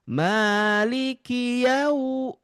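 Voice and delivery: slow Maliki yau, lips pushed forward; soft yau toward U. Shown as a demonstration. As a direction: draw yau out gently into U. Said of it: "itu yang bunyi ke U". (yau lembut ke U)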